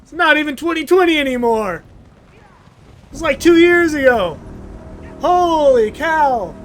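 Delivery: loud, high rant at himself; exasperated outburst. Real, not acted. Vlogger ranting that his video is outdated, loud and high. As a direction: blow up in mock frustration.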